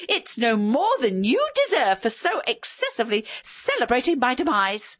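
high, shrill and gloating; shrill high wife voice. A narrator's reading. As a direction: lecture him with shrill glee. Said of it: "her voice zoomed up to its normal high-pitched shattering decibel level".

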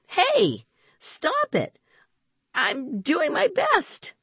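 high-pitched, flustered objection; indignant protest. Acted. Boy to the bluebottle dive bombing him, indignant protest. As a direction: fend it off, pitched right up.

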